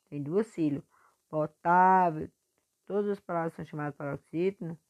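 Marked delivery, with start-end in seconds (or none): 1.3-2.3 "Potável" slow, with TA long and loud; teacher drawing out TA in "Potável"; stretched stressed syllable. Shown as a demonstration. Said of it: "puxando mais a sílaba forte".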